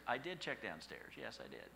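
ordinary talk, nothing marked

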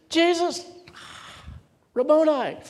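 high, loud cry of recognition; Mary's joyful cry. Acted. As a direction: call out his name in sudden joy. Mary cries out on recognizing Jesus.

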